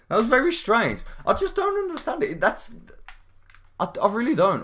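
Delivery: high loud disbelief; incredulous. Real, not acted. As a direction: protest in disbelief. Confused rant at failed hits.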